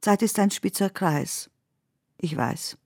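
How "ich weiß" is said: ordinary delivery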